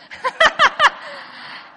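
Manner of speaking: high laugh. Real, not acted. Laughing at the boys.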